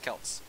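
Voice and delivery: Celts with a hard K at the start; K sound, not S, opening Celts; hard K pronunciation. Shown as a demonstration. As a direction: say Celts as "kelts". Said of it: "It's pronounced Celts in this context".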